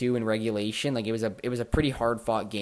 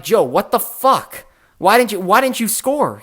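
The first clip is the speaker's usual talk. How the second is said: higher and louder, exasperated yelling at a teammate; retold angry outburst; 8 semitones higher, 10 dB louder than usual. To teammate Joe over a blown penalty shot, loud angry yell. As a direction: blow up at him in disbelief.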